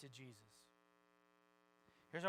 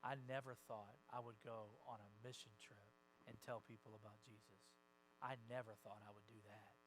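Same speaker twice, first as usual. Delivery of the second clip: grateful church lady, soft; 6 semitones lower, 11 dB quieter than usual. Retold or imitated. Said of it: "she said".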